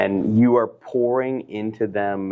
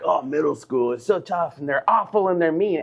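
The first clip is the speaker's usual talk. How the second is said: higher, sing-song mimicry of the usual warnings; mocking other parents; 7 semitones higher than usual. Mocking the usual middle school warnings, imitating other parents. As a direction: parody the tired cliché everyone repeats. Mocking what everybody says.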